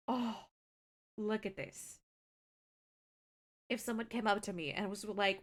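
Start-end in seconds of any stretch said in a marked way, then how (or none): none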